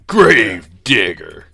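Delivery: slow drawn-out announcer; monster truck ad. Retold or imitated. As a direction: stretch it like a truck ad. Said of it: "those were some great commercials".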